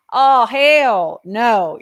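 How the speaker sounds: loud, slow, drawn-out refusal; big mock-defiant shout; defiant hell no. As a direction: belt it out like slamming a door on the devil. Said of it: "You know?".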